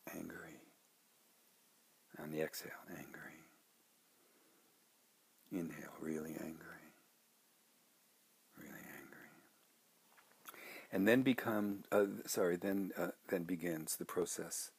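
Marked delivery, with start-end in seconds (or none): 0.1-9.3 very soft, sparse murmured words; hushed inward naming, fading away; guided meditation. Shown as a demonstration. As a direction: breathe each word out quietly, with long pauses. Models shortened meditation words.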